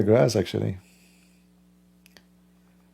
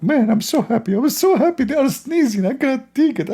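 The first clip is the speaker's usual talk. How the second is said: very high, giddy god voice; mock delighted god; faster than usual. Speaker mocks Allah enjoying sneezes in squeaky delight. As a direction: squeal as an overjoyed deity. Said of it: "like Allah, like".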